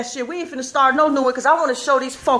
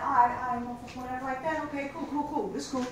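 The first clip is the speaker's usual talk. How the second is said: muttered fake calm; 9 dB quieter than usual. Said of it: "I'm like".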